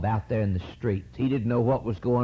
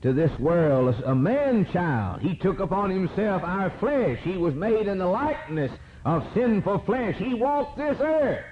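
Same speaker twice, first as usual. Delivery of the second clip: high, ringing preacher's cadence; fervent preaching; 5 semitones higher than usual. Acted. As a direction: preach it out with fire. Preaching at full force.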